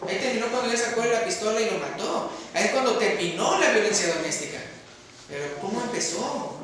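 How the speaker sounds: ordinary talk, nothing marked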